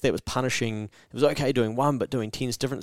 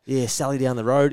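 ordinary talk, nothing marked

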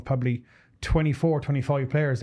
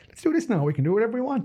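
carefree coach voice; 9 semitones higher than usual. Retold or imitated. Imitating coach making plays up.

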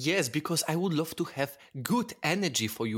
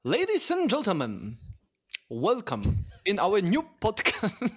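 higher-pitched mock English accent; 6 semitones higher than usual. Shown as a demonstration. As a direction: announce the show in a badly faked English accent, pitched up. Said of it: "What about your English one?".

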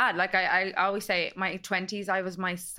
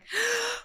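surprised gasp. Retold or imitated. Friend sees engagement ring.

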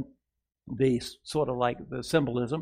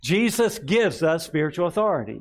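slow and raised, for the church to repeat; slow proclaimed declaration; 5 semitones higher, 4 dB louder than usual. Shown as a demonstration. Pastor leading the congregation in a slow declaration. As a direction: declare it slowly and strongly. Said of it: "Say it with me".

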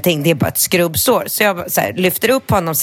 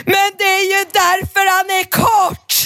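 put-on triumphant kid; slower than usual. Rambo triumphantly explaining to the teacher, high shout. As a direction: blurt it like a kid who just solved it. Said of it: "Och Rambo bara".

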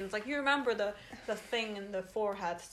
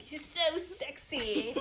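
drawn-out, higher-pitched gushing; mock swooning; 4 semitones higher, much slower than usual. Imitating herself gushing over the actor, mock swooning. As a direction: stretch it out like a swooning fan. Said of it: "me being like".

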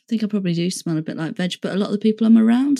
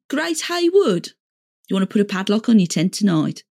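put-on East Midlands accent. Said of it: "I could probably do a Midlands accent now".

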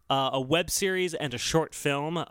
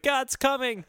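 high-pitched mock announcement; mock proclamation; 8 semitones higher, slower than usual. Host imagining a rally stunt, mock proclamation. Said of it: "and be like".

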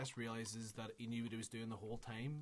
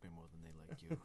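normal talking voice

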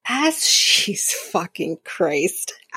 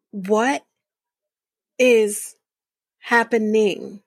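slow, word-by-word bafflement; baffled disbelief; much slower than usual. Retold or imitated. Viewer reacting to the sudden accent, slow baffled disbelief. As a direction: spell out the disbelief, a pause after each word.

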